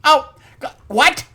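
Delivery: usual speaking voice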